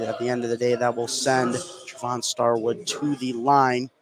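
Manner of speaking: ordinary talk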